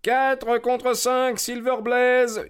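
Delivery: high, slow, drawn-out bookmaker cry; crowd calling odds. A narrator's reading. Parieurs qui hurlent les cotes, crié haut et lent. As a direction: call the odds across the ring. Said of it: "hurlait-on dans le ring".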